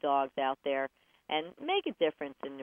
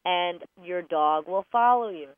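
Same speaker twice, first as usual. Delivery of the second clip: ordinary talk, nothing marked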